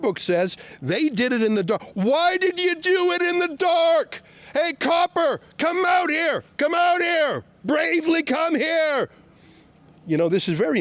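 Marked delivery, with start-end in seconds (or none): 1.9-9.1 shouting at copper. Real, not acted. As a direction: call him out of the car. Shouting at police.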